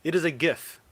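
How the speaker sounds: "gif" with a hard g, as in "gift"; insistent hard g. Real, not acted. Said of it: "pronounce it the wrong way".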